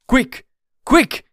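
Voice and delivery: high, loud, sharp cry; alarmed yell at a high pitch; urgent shout. A narrator's reading. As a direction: shout to rouse everyone. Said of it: "he shouted".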